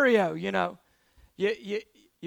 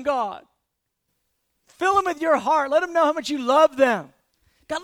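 building prayer, louder toward the end; fervent prayer; 4 dB louder than usual. Acted. Driver prays aloud for the cursing stranger, fervent prayer. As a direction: pray it out, rising in fervor.

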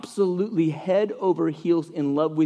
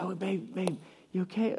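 put-on soft, tender check on a child; hushed concern; 2 semitones higher, 11 dB quieter than usual. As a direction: ask gently so nobody wakes. Dad hurries in, wife asleep.

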